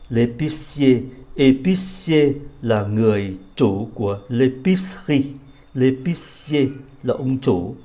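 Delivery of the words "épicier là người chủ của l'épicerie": ordinary talk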